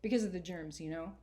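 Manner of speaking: put-on lively first-person reading of a boy's journal; story reading. A narrator's reading. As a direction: read it like a kid talking straight to you. Author reads her novel aloud.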